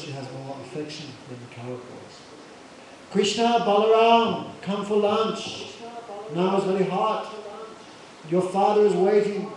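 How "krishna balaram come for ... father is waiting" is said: put-on mother calling children in to lunch; raised, higher voice that carries to children at play. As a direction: call them in, loud and insistent.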